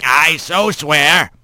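put-on slow gravelly old bird; gravelly old man. A narrator's reading. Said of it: "in that gravelly voice".

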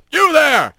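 yell. Acted. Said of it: "someone yelled".

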